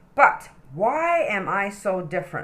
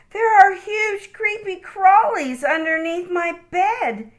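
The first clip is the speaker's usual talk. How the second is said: put-on complaining child; 13 semitones higher than usual. A narrator's reading. Okie complaining to Mom about creepy crawlies, high and whiny. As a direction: complain with a whine. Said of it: "complained Oakley".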